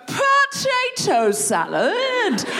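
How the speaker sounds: high and slow, spoken with a laugh; a bright, slow sample answer; demo answer. Shown as a demonstration. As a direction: draw it out as a cheerful example. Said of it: "you're just gonna go".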